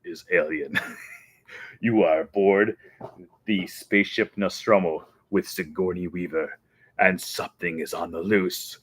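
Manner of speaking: low, hushed sci-fi trailer voice; lightly voiced ominous announcer; ride narrator. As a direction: build a spooky hush for the alien scene. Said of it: "the speaker would be like".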